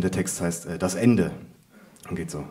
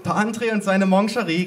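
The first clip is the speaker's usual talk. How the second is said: higher, amused mother voice; grinning tease; 8 semitones higher, 3 dB louder than usual. A narrator's reading. Mother teasing narrator about pralines, grinning. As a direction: tease it with a grin. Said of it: "grinste meine Mutter".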